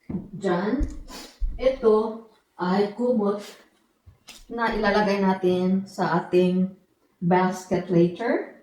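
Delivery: ordinary talk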